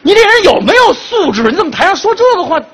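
high, fast indignant retort; indignant outburst. Pretend. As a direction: snap back in mock outrage. 假装被冒犯发火. (装作恼火地质问)

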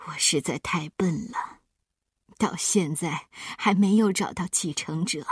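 put-on whispered tearful old man; weeping. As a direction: whisper through tears. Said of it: "开始嚎啕大哭". (老爷爷带着哭腔低语)

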